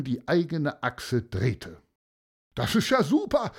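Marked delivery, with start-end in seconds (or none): 2.5-3.5 high, bright crowd cheer; excited call. A narrator's reading. Spectators cheering the kite, high excited call. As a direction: call out in delight. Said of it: "riefen die Leute".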